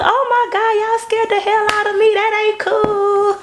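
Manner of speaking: high, shaken, half-laughing woman's voice; relieved girl. Retold or imitated. The girl tells the video crew they scared her, high and shaken. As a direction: imitate a scared girl catching her breath.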